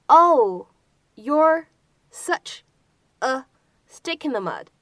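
each word set apart by pauses, stick in the mud kept together; word-by-word model. Shown as a demonstration. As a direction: break the sentence into slow separate pieces for learners. Teacher breaks sentence into pieces. (逐词慢速示范)

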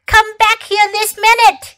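high, loud, urgent order; crying out. A narrator's reading. Fox calls floating kids back, urgent shout. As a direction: shout after runaway children. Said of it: "he cried".

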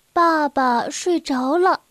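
plain reading voice

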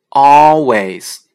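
loud "always" with both syllables drawn out; careful word demo. Shown as a demonstration. Teacher showing w inside a word, loud slow demonstration. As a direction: stretch "always", sounding the middle w as oo. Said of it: "如果W在单词的中间呢".

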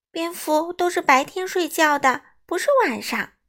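high, loud little bat complaint; indignant protest. A narrator's reading. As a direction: insist on it in protest. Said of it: "莱尼不满地叫起来". (又高又响，不满地争辩)

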